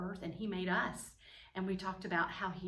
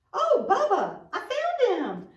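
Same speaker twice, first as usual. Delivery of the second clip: high, loud delighted cry; excited discovery; 12 semitones higher, 12 dB louder, slower than usual. Pretend. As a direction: play up a big find for small children. Pretends to discover the hidden mascot.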